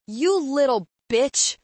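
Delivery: loud, high, slow furious yell; screamed insult. A narrator's reading. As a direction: hurl it out in a drawn-out scream. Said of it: "Kyle screamed".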